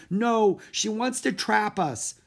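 put-on high, loud, slow anxious girl; fearful protest. A narrator's reading. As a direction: insist in fear. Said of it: "said Annie".

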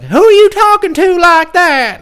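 very high, loud mock scolding girl voice; scolding neighbor girl. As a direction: shriek a scolding at him in a put-on girl's voice. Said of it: "she's like".

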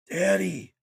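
raspy little whisper. A narrator's reading. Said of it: "she rasped in a terrible little whisper".

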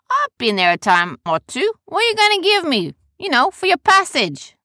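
loud, high character voice; brash trader calling down. Acted. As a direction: haggle from up on deck.

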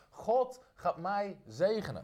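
slow, deliberate proclamation; slow, weighty confession of faith; slow declaration again. Shown as a demonstration. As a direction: let each word land slowly. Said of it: "Zeg het eens hardop".

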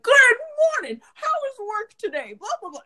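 imitating dad's chipper intrusion; mock-jolly dad trailing into blah blah; overly cheery dad. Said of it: "he'll be like".

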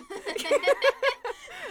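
squealing burst of laughter; high laugh. Real, not acted. Laughs at the fish name.

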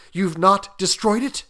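ordinary delivery, nothing marked

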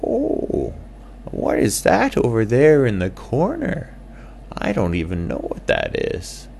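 high little bat character; curious squeaky bat. Acted.

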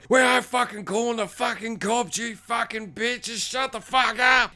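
high, strained mock rant, slowing on the insults; psycho rage. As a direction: imitate a man losing it. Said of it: "Jay fucking goes psycho".

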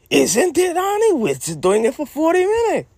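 very high, insistent coaxing; high-pitched coaxing. Acted. Coaxing Annie into forty minutes of fun, high-pitched coaxing. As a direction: push the question way up high. Coaxing partner to keep going.

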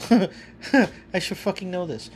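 self-mocking laugh. Real, not acted. Laughs at his own mistake.

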